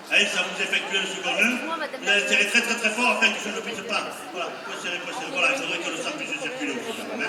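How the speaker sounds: ordinary talk, nothing marked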